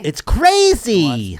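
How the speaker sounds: slow, high, drawn-out mock echo; drawn-out teasing parrot of a friend. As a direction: stretch the word crazy out in a high mocking sing-song. Mocking echo of guys line.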